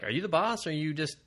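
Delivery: high-pitched mock questioning; retold exasperated question; incredulous challenge. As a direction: challenge him in disbelief. Challenging the game character's weakness.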